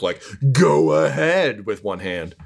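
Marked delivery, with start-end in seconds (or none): 0.5-1.6 slow, higher, drawn-out sarcasm; sarcastic. Acted. Begrudgingly handing over command with one hand, sarcastic. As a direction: grudgingly wave them on, dripping sarcasm. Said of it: "gives you a sarcastic".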